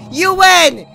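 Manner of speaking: raging gamer. Real, not acted. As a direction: yell it again at the screen, fed up. Repeats the yell.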